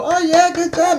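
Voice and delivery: hammed-up cheer for a fake star; mock applause. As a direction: sneer at the clueless fans. Mocking people clapping for stars.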